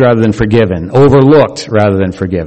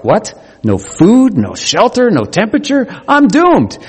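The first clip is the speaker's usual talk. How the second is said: mock baby panic, much higher, rising to the end; panicking baby; 9 semitones higher than usual. Preacher voicing baby left alone, high mock panic. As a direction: play the baby's dismay, pitch climbing higher. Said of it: "the baby for a moment is just taken apart".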